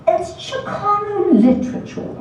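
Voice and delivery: high, sniffy pseudo-British remark; a lightly faked British accent, dismissive; pseudo-British professor sniffing at the book; pseudo-British disdain. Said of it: "in his crisp, pseudo-British accent".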